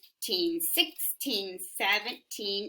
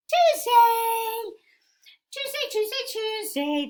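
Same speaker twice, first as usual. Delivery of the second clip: high bright Tuesday calls; excited high; 12 semitones higher, 5 dB louder than usual. Acted. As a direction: cheer the answer up high. Excited answer to children.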